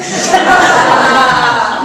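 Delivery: loud high laugh; real laughter; burst of laughter. Real, not acted. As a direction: laugh out loud at your own joke. Laughs at own taunt.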